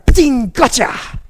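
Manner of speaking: the devil scoring a hit, hammed up; gloating enemy. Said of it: "the enemy's going".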